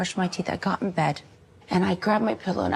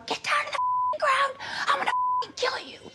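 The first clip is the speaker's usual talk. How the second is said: high, harsh mock gunman yell; yelled threat. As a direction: bark it out like an attacker. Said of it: "grabbed me, put me down".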